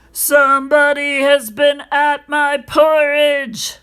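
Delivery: loud, high-pitched gruff bear voice; great huge bear. A narrator's reading. Big bear finds his porridge touched, loud gruff voice. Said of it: "in his great rough, gruff voice".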